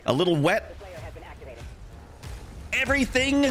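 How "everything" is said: hammed-up hype. Hyped stream intro.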